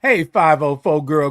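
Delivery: ordinary talk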